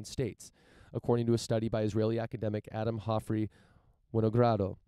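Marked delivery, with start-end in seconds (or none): 4.1-4.8 a hint of a Russian accent on Winogrado; lightly Russian-accented surname; Russian-like pronunciation. Real, not acted. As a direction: say Winogrado with slightly Russian-sounding vowels. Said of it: "that's like Russian. I don't know why I pronounced it that way".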